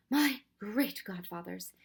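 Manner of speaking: slow, heartfelt exclamation; a touch of weary relief; with feeling. Acted. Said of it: "he was said with feeling".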